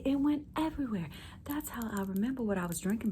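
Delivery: ordinary talk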